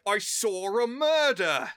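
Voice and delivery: high, slow English "saw-r a murdah"; exaggerated English accent, dropping R in "murder". Shown as a demonstration. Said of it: "ends with an R, you don't say it".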